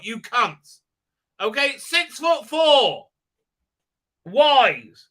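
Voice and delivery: high, loud indignant outburst; incredulous high-pitched tirade; exasperated rant. Real, not acted. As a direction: rant in disbelief, punching key words. Exasperated rant about the show.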